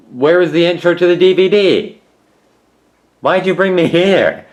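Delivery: loud, high-pitched complaint; loud indignant questioning; exasperated outcry. Real, not acted. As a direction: demand an answer from the DVD. DVD menu skips the intro.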